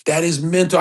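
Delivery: ordinary talk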